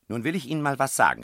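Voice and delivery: high, loud and fast; landlady rounding on the guests; angry call. A narrator's reading. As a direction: burst out at them. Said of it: "rief sie".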